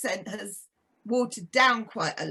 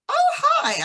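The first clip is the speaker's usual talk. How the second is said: very high, loud American greeting; American accent; 6 dB louder than usual. Retold or imitated. The friend coming over at the gym, gushing in an American accent. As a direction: mimic a gushing American hello. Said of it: "I think she had an American accent".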